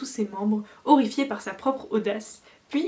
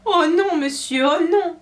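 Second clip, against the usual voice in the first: usual speaking voice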